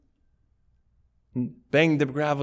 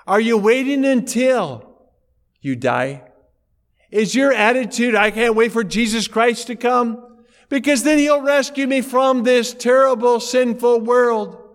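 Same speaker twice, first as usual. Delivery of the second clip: loud, higher preaching voice; preaching at full force; 8 semitones higher, 8 dB louder than usual. Acted. Preacher challenging the congregation, full-force preaching. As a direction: press the question on the congregation.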